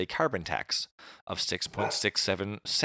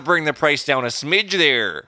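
hammed-up Canadian accent, louder and slow; mock Canadian accent; 5 semitones higher, 6 dB louder, slower than usual. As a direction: play a timid Canadian asking nicely, in a broad Canadian accent. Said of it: "Sorry, eh?".

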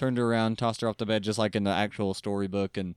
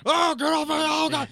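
high, loud panicked yelp; mock panic; 4 dB louder than usual. Grinch attacked by the cat, high panicked yelping. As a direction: squeal as a cat claws your face.

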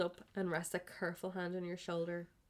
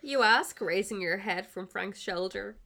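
ordinary talk

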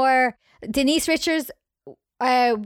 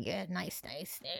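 very soft, low murmur of approval; mock leering; 3 semitones lower, 17 dB quieter than usual. Imitating a director eyeing up dancers, quietly leering.

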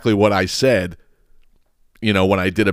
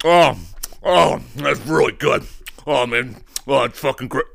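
imitation of a reviewer savoring a bite; hammed-up food moans between words; mock tasting rapture. Podcaster parodying a reviewer after the first bite, mock savoring.

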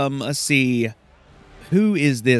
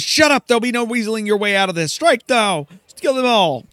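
louder, higher, snapping orders; a haughty noblewoman barking her order to strike, higher and louder; angry Silky; 8 semitones higher, 4 dB louder, faster than usual. Acted. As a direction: cut them off and command the attack. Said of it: "Silky goes".

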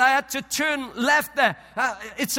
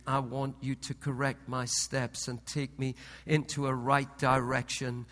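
softer, lower repentant prayer; humble prayer; 9 semitones lower, 8 dB quieter than usual. Retold or imitated. Preacher retelling his apology to God, softer lower humble prayer. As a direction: confess quietly to God. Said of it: "I said".

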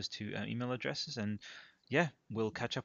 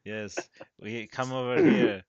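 slow, louder hammed-up creep; mock creepy voice; 9 dB louder, slower than usual. Host teasing co-host by mimicking his creepy slow voice. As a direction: drawl it out like a creepy stranger. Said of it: "dial it down and you're like".

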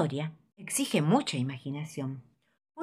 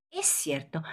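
softer, whispered concession; whispered aside; 6 dB quieter than usual. Acted. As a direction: breathe it under the voice. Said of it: "dice el grillo".

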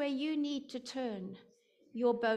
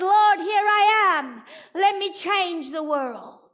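loud vow; 5 semitones higher, 11 dB louder than usual. Retold or imitated. Answering the Lord after the stone, a loud vow. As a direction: declare it with full force. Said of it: "I said".

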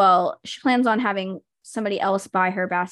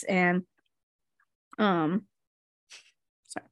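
ordinary talk, nothing marked

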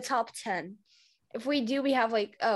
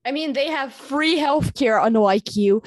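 louder taunting jab; loud teasing; 3 semitones higher, 9 dB louder than usual. Acted. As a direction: push it loud to needle him. Teasing friend in argument.

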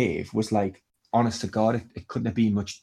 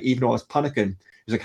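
usual speaking voice

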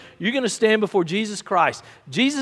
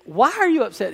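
acted questioner; 5 semitones higher, 3 dB louder than usual. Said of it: "he said".

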